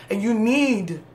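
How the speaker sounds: "need" drawn out for emphasis; stress on NEED. Real, not acted. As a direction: lean hard on "need" and hold it. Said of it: "emphasis on the word need".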